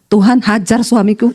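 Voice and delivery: low, slow mock-innocent prayer; wife's deadpan prayer. Wife repeats the taught prayer deadpan. (istri berdoa pelan dengan suara rendah)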